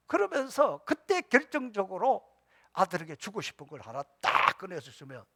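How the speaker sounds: ordinary delivery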